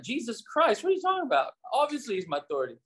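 high, incredulous mock outrage; indignant retort. Pastor retelling his indignant reply to Randall, high incredulous mock outrage. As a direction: replay your own huffy disbelief. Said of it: "And I was like".

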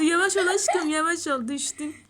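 laughing coo. Real, not acted. Owner laughing at her kitten tumbling, high laughing coo. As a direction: giggle it out to a clumsy kitten. Cooing at kitten falling over.

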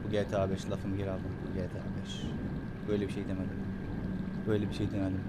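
low, soft backpedalling; hushed, low repeated denial; retracting quietly. Real, not acted. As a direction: drop to a low, quiet voice to take it back. Hastily takes words back.

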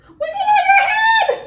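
very high, loud, quick squeal. Shown as a demonstration. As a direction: squeal it way up top. Action song, contrasting body heights.

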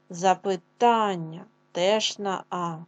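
запитання said slowly with the А held long; stress on А in запитання. Shown as a demonstration. Teacher to class, slowly stressing запитання on А. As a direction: draw out the А of запитання. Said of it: "Проговоримо".

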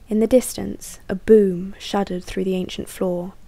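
ordinary delivery

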